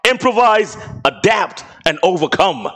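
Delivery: usual speaking voice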